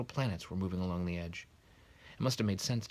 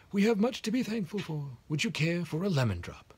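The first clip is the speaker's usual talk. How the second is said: put-on whimsical old headmaster, pitched higher; higher old wizard voice; 12 semitones higher, 7 dB louder than usual. A narrator's reading. Dumbledore offering McGonagall a lemon drop, higher and whimsical. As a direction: offer the sweet with a twinkle.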